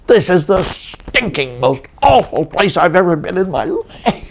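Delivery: high-pitched, slowed, indignant complaint; imitating a fed-up director venting; mock exasperated rant. Retold outburst of Hirsch.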